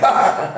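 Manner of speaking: high, loud imitated yell; short high shriek, played for laughs; mock scream. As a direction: let out a hammed-up gamer scream. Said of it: "And he's like".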